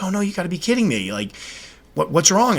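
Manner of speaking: lightly voiced wife's shock; alarmed surprise. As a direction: sound suddenly alarmed for the spider. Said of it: "And she's like".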